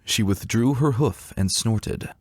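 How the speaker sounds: ordinary delivery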